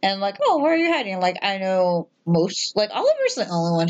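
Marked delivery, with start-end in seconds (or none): 0.5-1.2 high, sing-song calling to pet; coaxing call to hiding cat. Retold or imitated.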